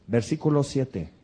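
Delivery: ordinary delivery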